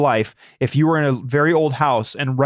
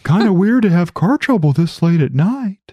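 creepy stranger voice; 5 semitones higher, 3 dB louder than usual. Pretend.

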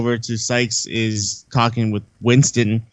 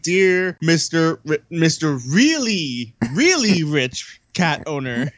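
slow, drawn-out, higher quoted letter; mock letter reading; 5 semitones higher, slower than usual. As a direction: stretch each word like a laboured note. Reading out clumsy ransom note.

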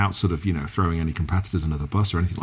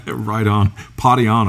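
put-on loud, higher, clumsy American surfer-dude accent; Bill and Ted bad American accent; 5 semitones higher, 9 dB louder than usual. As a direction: have a go at an American dude accent and fail, laughing. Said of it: "I can't do my American accent".

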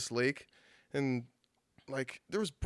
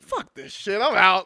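raised pitch, building to loud; mock outburst, louder at the end; retold fed-up outburst; 7 semitones higher, 11 dB louder, slower than usual. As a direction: act out giving up in exasperation. Retells giving up on the day.

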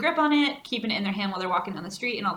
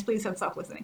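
ordinary talk, nothing marked

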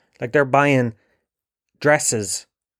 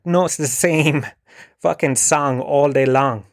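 normal talking voice

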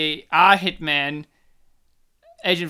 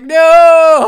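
high, very loud drawn-out shout; over-the-top cry of refusal; anguished mock cry; 10 semitones higher, 16 dB louder than usual. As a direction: wail it out in hammed-up despair. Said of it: "And he's like".

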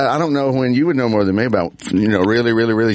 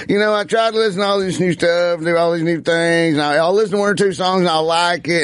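higher-pitched retold quote; imitated comedian; 5 semitones higher than usual. Comedian asked about his music at meet and greet, imitated. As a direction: imitate him answering fans, pitched up. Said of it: "and he was like".

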